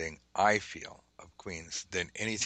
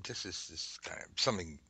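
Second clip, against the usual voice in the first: ordinary delivery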